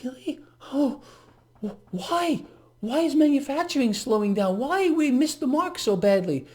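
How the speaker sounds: high, feigned-innocent puzzlement; mock bewilderment. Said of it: "So it's like".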